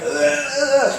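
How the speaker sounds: laughing imitation of gagging "ugh ugh"; mock gagging. Pastor imitating family gagging on bad beans, laughing. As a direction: fake a retch while laughing. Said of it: "like, puking".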